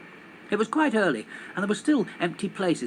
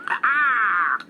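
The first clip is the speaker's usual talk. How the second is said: loud, higher raven caw; raven squawk; 7 semitones higher, 7 dB louder than usual. Acted. Raven squawking at the running strangers. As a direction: let out a startled raven cry.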